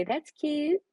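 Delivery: high, airy cooing "That's cute"; high sing-song cooing over a follow-back. Retold or imitated. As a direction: coo it like a delighted fan. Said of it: "I would say".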